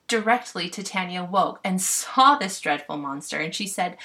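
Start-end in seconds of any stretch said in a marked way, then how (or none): none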